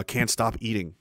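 normal talking voice

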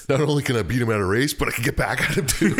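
laughing with glee. Real, not acted. Gleeful about beating rival.

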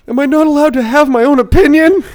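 high, loud wailing whine; mock crying. Crying kid protesting at the diner, wailing. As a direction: wail it like a crying child. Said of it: "he starts crying, goes".

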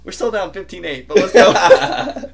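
half-laughing pep call; laughing rally cry; reluctant hype. Retold or imitated. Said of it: "And I was like".

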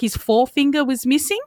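usual speaking voice

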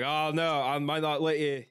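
shaky stab at a Boston cop; attempted Boston accent. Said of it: "I can't do the Boston accent".

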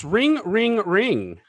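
high ring, ring; phone ring imitation. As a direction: chime it like a phone. Mimicking phone ringing.

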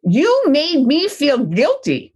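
slow, hurt blaming tone; a parent's resentful reproach; guilty accusation. Retold or imitated. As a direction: accuse the child with wounded guilt. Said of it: "This is how parents often react".

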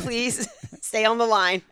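slow mock phone message, laughing; drawn-out automated announcement broken by a laugh; hold-line recording. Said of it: "I like to say".

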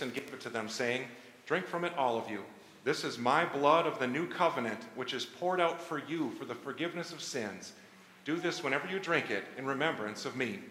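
normal talking voice

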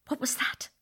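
breathless frightened whisper; hushed, startled by the bang. Acted.

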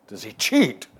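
loud, mock-astonished repeat of a question; incredulous echo. Golfer echoes the writer's question about Trump, incredulous.

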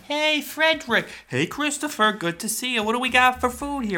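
high, bright welcome to friends; cheerful party greeting. Pretend. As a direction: greet your buddies at the door with big energy.

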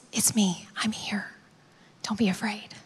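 low, hushed comfort; gentle reassurance. Acted. Jesus to those facing life's hardest, tenderly reassuring. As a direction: soothe softly, almost whispering.

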